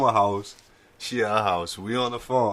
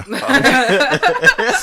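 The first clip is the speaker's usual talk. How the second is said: laughing; 12 semitones higher, 6 dB louder than usual. Real, not acted. Laughing punchline.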